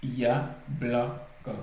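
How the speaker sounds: Yablaka very slowly, split as ya, bla, ka; syllable by syllable. Shown as a demonstration. Teacher counting out three syllables for learners, Yablaka slowly broken up. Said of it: "donc trois syllabes".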